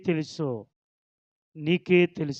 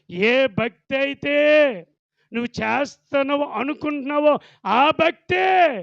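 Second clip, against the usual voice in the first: preaching shout; 6 semitones higher, 4 dB louder than usual. Real, not acted. Preacher warning about false devotion.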